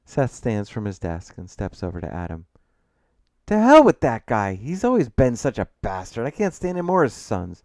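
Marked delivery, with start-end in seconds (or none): 3.5-7.6 loud start, high swoops of exasperation; disgusted outburst. Acted. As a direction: vent about the man with feeling. Brother curses the departed client.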